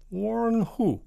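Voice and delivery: high, loud premier voice; Soviet premier. Acted. Soviet premier playing along with the joke, high voice. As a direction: ask high and puzzled.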